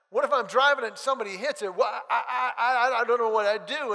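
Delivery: a flustered laugh over wrecking the car; nervous laughing worry. Retold or imitated. Anxious laughing about wrecking car.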